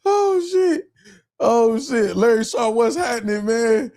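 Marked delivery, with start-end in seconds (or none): none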